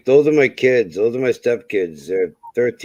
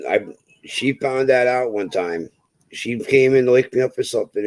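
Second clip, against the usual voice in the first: ordinary delivery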